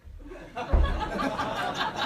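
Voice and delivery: breathy high burst of laughter; real laugh. Real, not acted. Telling the story to his audience, a real breathy laugh. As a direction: let a real laugh break out.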